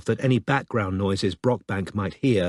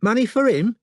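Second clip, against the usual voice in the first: much higher London woman, suddenly interested; less hostile; 13 semitones higher, 5 dB louder than usual. A narrator's reading. London woman hears of money, higher and eager. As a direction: perk up at the word money. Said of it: "with a degree less animosity".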